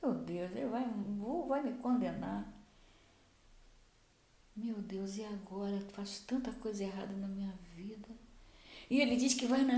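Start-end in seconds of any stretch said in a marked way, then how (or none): 0.0-8.1 hushed, low inner monologue; Zacchaeus's dismayed thoughts. Acted. Zacchaeus dreading condemnation for his wrongs, quiet dismay. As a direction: worry under your breath, acting out a guilty man. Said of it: "O que que Zaqueu sentiu?".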